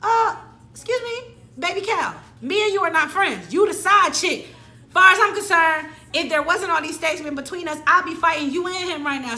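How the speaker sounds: high, loud sassy put-down; indignant telling-off. Acted. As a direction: put her in her place, affronted. Acted telling-off of the other woman.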